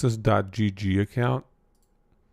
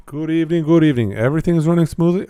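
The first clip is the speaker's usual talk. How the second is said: excited greeting; 5 semitones higher, 5 dB louder, much faster than usual. Real, not acted. Greeting chat, louder and higher.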